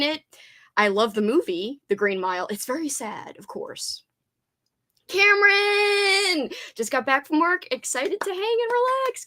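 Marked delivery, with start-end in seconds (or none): 5.1-6.5 elated call. Real, not acted. Greeting a friend joyfully.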